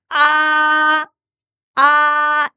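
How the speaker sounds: high flat sustained 아 held without wavering; level first tone. Shown as a demonstration. Teacher demonstrating Mandarin first tone, high level 아. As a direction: sing 아 on one high level pitch, no dip. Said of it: "아를 더 평평하게 읽어볼게요".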